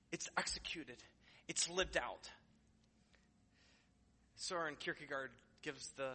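ordinary talk, nothing marked

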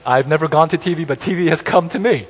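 usual speaking voice

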